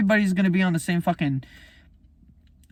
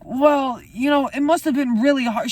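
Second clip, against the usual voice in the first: higher, louder mock sympathetic voice; mocking sympathy; 10 semitones higher, 4 dB louder than usual. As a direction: parody a pitying bystander. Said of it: "would have been like".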